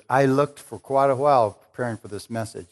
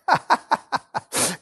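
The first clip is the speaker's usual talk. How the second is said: high delighted laugh; 11 semitones higher than usual. Real, not acted. Laughing after the acted quote.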